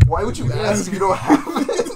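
loud, high, drawn-out imitated complaint; acted-out comic outrage; mock exasperation. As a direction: complain loudly through laughter. Acting out exasperated film line.

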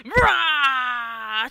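loud roaring war cry; battle cry. Acted. Keeper roaring as the fight begins, loud cry. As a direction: roar to attack.

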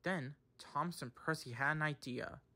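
ordinary delivery, nothing marked

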